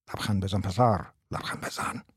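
low, hushed stage prompt; sneaky prompter whisper. A narrator's reading. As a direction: hiss it softly like a theatre prompter. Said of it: "مثل سوفلورها یواشکی گفت". (یواشکی، مثل سوفلورها)

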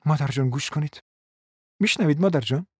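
lightly voiced earnest appeal; a touch of seriousness, lifting at the end; serious tone. A narrator's reading. Daughter asking her mother to listen, mildly serious. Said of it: "با لحنی جدی گفت".

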